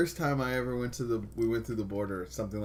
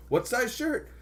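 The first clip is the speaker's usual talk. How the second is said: eager giveaway; 13 semitones higher, 6 dB louder than usual. Retold or imitated. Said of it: "I just kept giving them".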